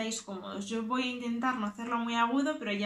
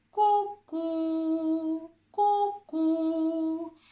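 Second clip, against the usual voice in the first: higher hoots "Cu, cu", slightly lower than before but still up; owl hoot, a bit lower; 5 semitones higher, 4 dB louder, much slower than usual. Shown as a demonstration. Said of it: "Un poquito más grave lo hago ahora". (ulular de búho)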